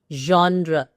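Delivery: "genre" as ZHON-druh; correct pronunciation. Shown as a demonstration. Teacher confirming the perfect form, "genre" ending in uh. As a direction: neutral "uh" at the end of "genre". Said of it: "is the perfect pronunciation".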